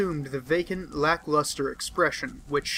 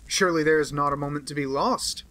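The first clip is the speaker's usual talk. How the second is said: usual speaking voice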